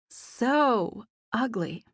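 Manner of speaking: slow, drawn-out sarcasm; sarcastic. A narrator's reading. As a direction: stretch it out, dripping sarcasm. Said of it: "with obvious sarcasm".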